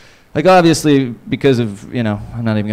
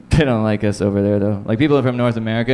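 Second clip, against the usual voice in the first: usual speaking voice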